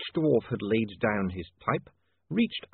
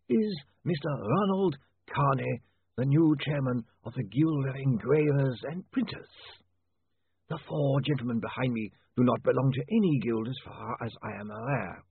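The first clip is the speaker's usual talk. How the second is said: zombie lawyer; 5 semitones higher than usual. Acted. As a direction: stretch each word with chilly formality. Zombie lawyer introduces guild chairman.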